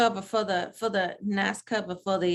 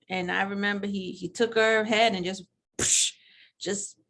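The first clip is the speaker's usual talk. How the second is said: usual speaking voice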